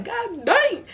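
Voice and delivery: high-pitched retold outburst; pained exclamation. Patient reacting as doctor pulls the stitch, high pained exclamation.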